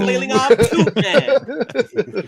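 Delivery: high, slow, laughing; laughing exclamation. Acted. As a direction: stretch it out and laugh. Joke about inflatable tube men.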